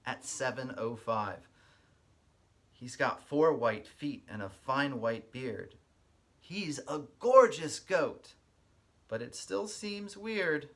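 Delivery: lively storybook read-aloud with a sing-song lilt; reading to children. Acted. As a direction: read it like story time for little kids. Reads picture book aloud.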